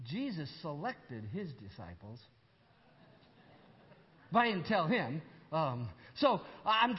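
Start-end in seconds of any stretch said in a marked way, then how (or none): 0.1-2.2 inner thought. Retold or imitated. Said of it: "I thought to myself".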